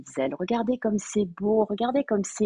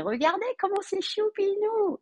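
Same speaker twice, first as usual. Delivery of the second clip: cutesy high voice; 12 semitones higher than usual. Real, not acted. Gushing over cute decoration.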